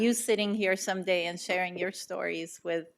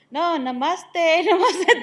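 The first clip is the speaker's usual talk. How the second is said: higher, louder, laughing through the word namaste; laughing correction; 9 semitones higher, 10 dB louder, slower than usual. Retold or imitated. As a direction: correct the greeting while bursting into laughter. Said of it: "They say".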